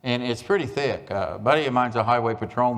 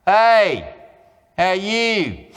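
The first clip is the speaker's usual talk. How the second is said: mock casual greeting; 7 semitones higher, 6 dB louder, much slower than usual.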